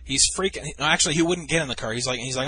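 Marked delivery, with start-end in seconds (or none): none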